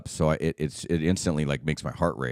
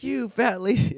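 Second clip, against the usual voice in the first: much higher put-on sergeant insult; drill instructor insult; slower than usual. Drill instructor insulting Private Pyle, high mock sneer. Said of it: "calling him all these names".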